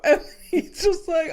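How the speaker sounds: ordinary delivery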